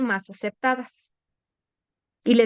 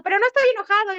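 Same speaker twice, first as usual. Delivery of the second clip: higher, half-shouted denial of being angry; mock angry denial; 10 semitones higher, 3 dB louder, much faster than usual. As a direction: snap back, insisting you're calm. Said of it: "Pero estás gritando". (negación enojada a medio grito)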